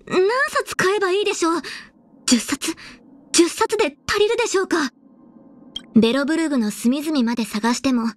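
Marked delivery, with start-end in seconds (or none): 0.1-4.9 high, stammering fan panic; flustered excitement. Acted. Fan wondering how many copies to buy, flustered excitement. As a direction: get giddy and flustered, pitch jumping up.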